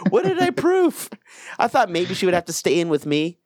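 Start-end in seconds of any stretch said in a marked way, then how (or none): 0.1-1.1 puzzled afterthought. Retold or imitated. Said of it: "I'm like".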